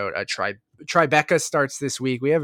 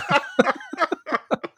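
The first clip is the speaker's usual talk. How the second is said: burst of laughter. Real, not acted. Laughing at a hockey name joke.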